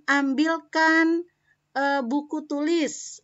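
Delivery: slow, high and loud "Ambilkan buku tulis"; firm instruction; commanding tone. Shown as a demonstration. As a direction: order it plainly to the class. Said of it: "itu perintah".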